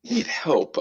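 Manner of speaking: usual speaking voice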